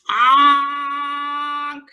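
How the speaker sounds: long, high held honk; scared truck honk. A narrator's reading. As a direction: honk like a frightened truck calling out. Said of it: "cried the dump, and he sounded scared".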